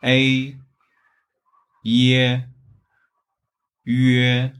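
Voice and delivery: A, Ye, Yue as drawn-out level Mandarin syllables; Chinese pronunciation. Shown as a demonstration. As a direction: model "A", "Ye", "Yue" in Chinese, each held level. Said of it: "In Chinese".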